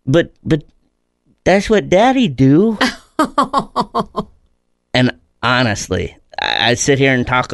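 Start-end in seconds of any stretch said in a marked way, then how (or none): 0.0-2.8 put-on high, slow little kid voice; toddler voice. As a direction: imitate a small child pleading innocently. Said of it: "And he says".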